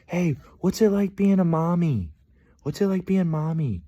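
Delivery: put-on high, childish asking voice; kid voice. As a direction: play a curious little kid. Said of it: "they're like".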